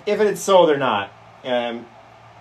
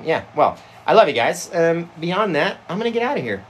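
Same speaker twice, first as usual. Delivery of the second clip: ordinary talk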